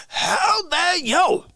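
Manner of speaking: high, slow drawn-out "How dare you?"; hammed-up high indignant cry; mock outrage. Catchphrase outburst at chatter.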